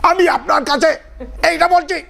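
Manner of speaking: high, strained Bengali speech-making; shouting orator. Retold or imitated. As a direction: imitate a preacher yelling at the crowd. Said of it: "like in Bengali, they might say".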